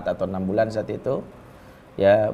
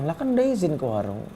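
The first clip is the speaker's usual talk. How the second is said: wife answering innocently; 4 semitones higher, 4 dB quieter than usual. Retold or imitated. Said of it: "istri saya bilang".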